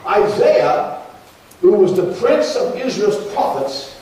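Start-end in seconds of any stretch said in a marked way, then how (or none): none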